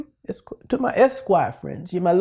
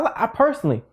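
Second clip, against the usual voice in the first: ordinary talk, nothing marked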